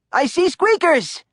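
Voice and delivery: high, eager yell; Stanley yells down to the class from the ceiling. A narrator's reading. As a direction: call down from the ceiling. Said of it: "Stanley yelled".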